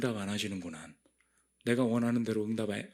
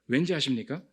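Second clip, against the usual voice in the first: usual speaking voice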